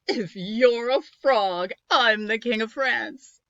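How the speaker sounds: loud, slow sneering witch; mock the prince like a gruff witch. Sarcastic witch voice.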